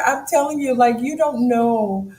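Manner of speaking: ordinary talk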